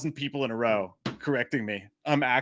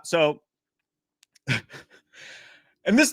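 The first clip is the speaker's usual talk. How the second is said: ordinary talk, nothing marked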